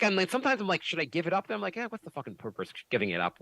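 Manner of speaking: ordinary delivery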